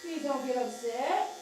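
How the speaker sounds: slow, drawn-out coaxing chant; sing-song plea. Real, not acted. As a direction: soothe the dog with a lilting, repeated plea. Soothing the dog with chanted plea.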